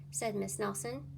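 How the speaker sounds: plain reading voice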